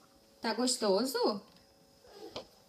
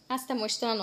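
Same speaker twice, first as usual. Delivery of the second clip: ordinary talk, nothing marked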